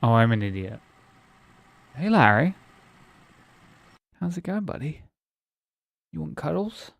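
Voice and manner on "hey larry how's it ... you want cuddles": pet talk. Real, not acted. Talking to pet cat.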